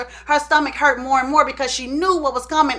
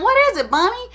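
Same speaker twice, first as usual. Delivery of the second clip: raised questioning tone; higher asking voice; 8 semitones higher than usual. Retold or imitated. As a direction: ask higher, coaxing. Mother pressing her child.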